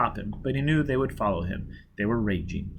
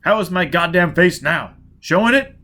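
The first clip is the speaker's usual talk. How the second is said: angry; 6 semitones higher, 7 dB louder than usual. Acted. Foyle angry at his face.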